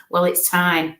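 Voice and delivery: normal talking voice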